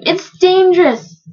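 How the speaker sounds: high, slow, urgent warning; plead, stretching the words. A narrator's reading. Bartholomew warning the captain of danger, pleading. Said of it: "pleaded Bartholomew".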